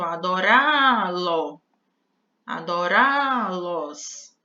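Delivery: "Adorá-lo" said very slowly with the stress on "rá"; slow stressed demo. Shown as a demonstration. Teacher to class, demonstrating the accented "rá" very slowly. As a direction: draw out "Adorá-lo. Adorá-los." and lean on "rá". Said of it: "o verbozinho aqui vai ser acentuado".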